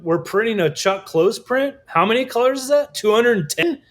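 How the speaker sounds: can't believe the size of this job; incredulous. Retold or imitated. Newcomer gasping at the huge Chuck Close print, incredulous and high.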